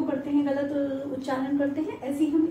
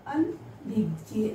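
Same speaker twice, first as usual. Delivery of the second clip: slow spelled-out word; much slower than usual. Shown as a demonstration. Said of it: "और फिर से आ गया".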